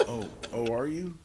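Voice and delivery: usual speaking voice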